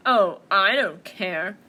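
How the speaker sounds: loud, slow, dismissive bear. Acted. Bear brushing off mouse, loudly dismissive. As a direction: boom it out without sympathy.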